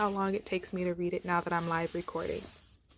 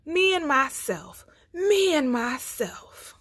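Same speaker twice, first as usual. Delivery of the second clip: lion's roar; 8 semitones higher than usual. A narrator's reading. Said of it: "sticking out his chest and roaring".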